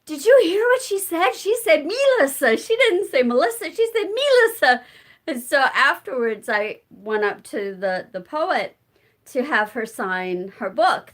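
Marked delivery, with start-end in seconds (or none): 0.0-4.8 excited friend. Retold or imitated. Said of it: "She's like".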